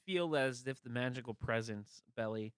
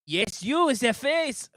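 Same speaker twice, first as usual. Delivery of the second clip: guard voice; 10 semitones higher, 16 dB louder than usual. Acted. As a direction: bellow like a pompous royal guard.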